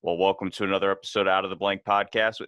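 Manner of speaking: polished radio host voice; professional voice. Shown as a demonstration. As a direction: read it like a podcast announcer. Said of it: "in my professional voice".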